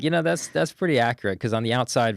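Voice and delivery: amused reply. Retold or imitated. Amused reply after laughing.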